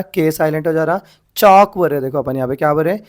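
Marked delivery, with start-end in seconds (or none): 1.4-1.8 चॉक punched loud with no L; long vowel straight into K in चॉक; silent L. Shown as a demonstration. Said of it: "एल silent आ जा रहा".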